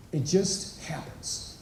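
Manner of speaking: "It just happens" breathed slow and soft; hushed repeat. Shown as a demonstration. Repeating his point to the men, slow and whispered. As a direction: let "happens" sink into a near whisper.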